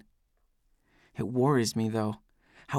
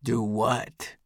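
wary. A narrator's reading. Drunk Eldest asked to confess, mildly wary. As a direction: answer with a touch of suspicion. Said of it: "he asks warily".

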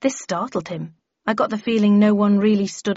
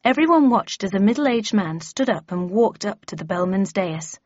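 ordinary delivery, nothing marked

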